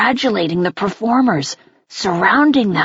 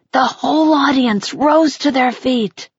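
ordinary delivery

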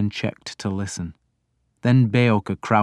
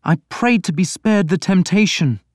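put-on higher, louder young prince voice; a troubled prince pleading; Alfred, anguished prince; 10 semitones higher, 4 dB louder than usual. A narrator's reading. Reader voicing Alfred to his priest, higher and louder.

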